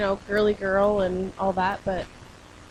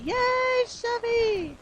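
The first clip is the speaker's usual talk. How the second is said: excited cheer; 14 semitones higher, much slower than usual. Real, not acted. Cheering at the yellow truck.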